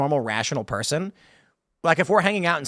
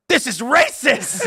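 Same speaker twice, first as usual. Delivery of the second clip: slow mock outrage; 8 semitones higher, 4 dB louder, much slower than usual. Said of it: "He's like".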